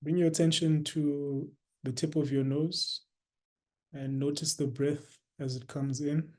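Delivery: soft, unhurried meditation guidance with long pauses; guided meditation. Real, not acted.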